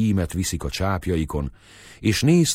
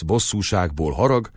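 plain reading voice

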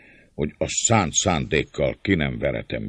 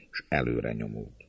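plain reading voice

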